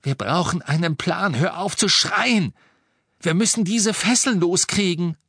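high, loud, insistent plea; exasperated urgent command. A narrator's reading. Urging a panicking friend to stop.

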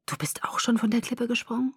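hushed, whispered disbelief; stunned. Acted. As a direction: ask it softly, barely believing it. Stunned realisation friend jumps too.

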